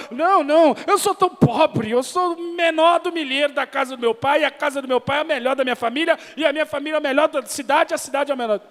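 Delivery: high, fast hammed-up protest; humble-bragging excuse. Gideon-style excuse voiced for the church, high fast mock protest. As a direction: fake humility rattled off fast. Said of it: "como o pastor Eliezer falou".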